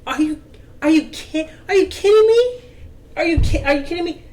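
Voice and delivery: high incredulous disbelief, peaking on kidding; are-you-kidding-me disbelief; mock exasperated wife. Wife's disbelief at husband.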